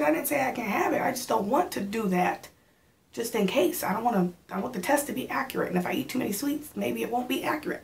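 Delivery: explaining to her man why no sweets, defensive; defensive retort. Retold or imitated. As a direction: justify yourself fast to him.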